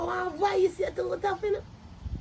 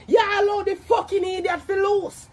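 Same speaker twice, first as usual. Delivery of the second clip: ordinary delivery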